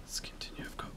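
soft, breath-only whisper. Real, not acted. Gamer keeping quiet in a horror game, whispering. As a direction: whisper so the game's mic won't catch you. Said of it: "Why am I whispering so much".

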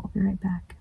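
soft unvoiced whisper. Real, not acted. Vlogger stepping away from the camera, whispering. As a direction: murmur it under your breath.

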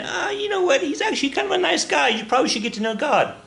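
high, syrupy reassuring voice; sweet nice-guy voice. Retold or imitated. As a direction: sell God as a lovely fella, way up high. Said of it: "now we're saying".

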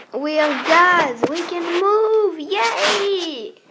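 loud, high cheering; excited shout. Acted. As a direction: shout for joy. Cheering as gas.

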